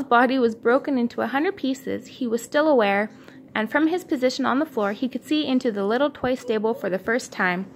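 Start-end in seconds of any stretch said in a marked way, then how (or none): none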